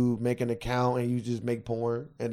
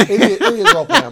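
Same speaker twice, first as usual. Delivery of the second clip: high, fast giggly admission; laughing; 13 semitones higher, 10 dB louder, faster than usual. Real, not acted. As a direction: laugh it out quickly.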